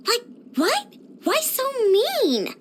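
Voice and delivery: high, drawn-out whiny protest; pouty, offended complaint; hurt indignation. Acted. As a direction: whine at the unfairness of it. Indignant at being turned down.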